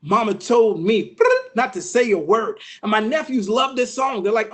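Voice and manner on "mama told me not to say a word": put-on hitched, gasping child after a spanking; spanked child. Nephews' uncle demonstrates eighties trap music, spanked child's hitching voice. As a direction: catch the breath between phrases like a spanked kid. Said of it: "You'd be like, 'Mama told me".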